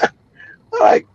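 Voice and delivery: high giggle breaking through; cracking up mid-sentence; laughing. Real, not acted. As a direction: laugh out the words. Laughing while telling.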